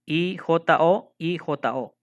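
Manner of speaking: I J O letter by letter, slowly; spelled out. Shown as a demonstration. Teacher showing that vowels and consonants repeat, I J O spelled out slowly. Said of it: "Se repiten las vocales y los consonantes".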